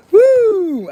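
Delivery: high, falling whoop; long, loud "woo" of relief. Real, not acted. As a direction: let out a high whoop after laughing. Recovering from laughing fit.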